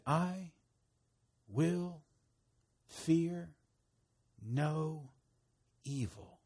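each word alone, with a long pause after it; word-by-word recitation. Shown as a demonstration. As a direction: lead listeners through it slowly, one word at a time. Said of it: "say this with me".

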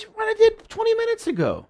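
very high-pitched, strained retold protest; retold incredulous insistence. Elder reminding God of his mistake, high incredulous protest.

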